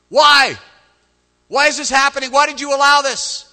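high, loud outcry; anguished demanding shout. Acted. Believer demanding an explanation from God, accusing shout.

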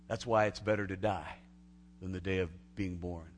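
usual speaking voice

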